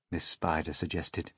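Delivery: usual speaking voice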